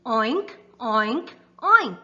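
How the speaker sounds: put-on be the pig for the kids; pig oink. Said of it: "Pig says".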